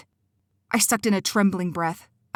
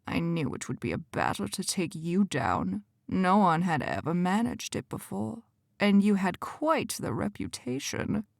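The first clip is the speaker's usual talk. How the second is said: quiet menace; 5 dB quieter than usual. Acted. Quentin mocks Cora's ignorance, soft and menacing. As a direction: lean in and threaten gently. Villain voice in the story.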